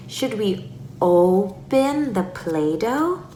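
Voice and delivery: "open" drawn long, rising question; slow down and stretch "open"; slow play invitation with a long "open"; stretched-out key word. Shown as a demonstration. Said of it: "stretch out words".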